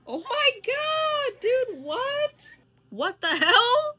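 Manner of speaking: high, drawn-out shocked questions; stunned disbelief. Real, not acted. Viewer shocked by poetry going around school, incredulous. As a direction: stretch it out high, appalled.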